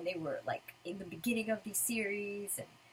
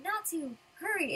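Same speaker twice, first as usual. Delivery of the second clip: high little call; lightly voiced, urging him along; Wendy; 8 semitones higher than usual. Wendy calling Natsu to hurry, high voice. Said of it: "Wendy's going".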